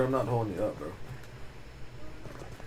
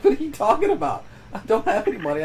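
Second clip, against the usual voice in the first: higher, louder mock disbelief, fast; incredulous retort; 9 semitones higher, 3 dB louder, faster than usual. Retelling his sister asking for money, incredulous. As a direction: scoff at the sister's ask, pitched up.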